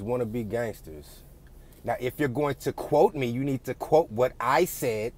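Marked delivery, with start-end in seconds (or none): 1.8-5.1 high, loud mock female delivery; mocking, emphatic swoops in a raised voice; mimicked women's inflection. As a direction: imitate a wannabe gangster talking like a woman. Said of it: "using the same voice inflection".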